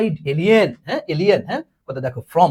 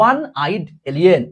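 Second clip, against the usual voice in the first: slow dictation; 3 dB louder, much slower than usual. Acted. Dictating an English sentence.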